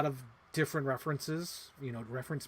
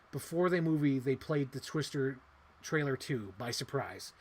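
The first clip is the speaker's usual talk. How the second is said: ordinary talk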